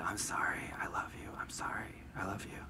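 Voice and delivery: very soft, near-whispered apology; meek drunken apology. Retold or imitated. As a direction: let each phrase out barely audibly. Retold drunk friend's meek apology.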